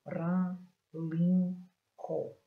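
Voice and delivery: "rã, lim, có" each punched alone; separate, forceful beats on "rã, lim, có"; isolated strong syllables. Shown as a demonstration. Teacher pulling out strong syllables, "rã, lim, có" each stressed. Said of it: "naquela sílaba mais forte".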